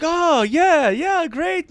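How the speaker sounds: high-pitched mock enthusiasm; imitated delight. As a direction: gush like a thrilled stranger. Retold delighted reaction of strangers.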